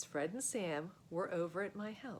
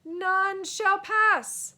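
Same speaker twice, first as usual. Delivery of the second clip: slow, huge, high-pitched knightly bellow; booming knight challenge; 11 semitones higher, 14 dB louder, much slower than usual. Acted. Knight blocking the path bellows at the boys. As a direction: halt them at the bridge with a thunderous decree.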